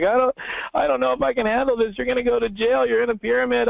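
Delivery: high-pitched mock freak-out; imitating tearful panic. Girlfriend to her network-marketer partner, imitated tearful panic. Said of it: "she comes in and she's in tears".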